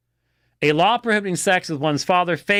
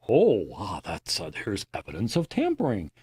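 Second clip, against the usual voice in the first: 1 mock scholar; 9 semitones lower, 6 dB quieter than usual. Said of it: "you turn it into".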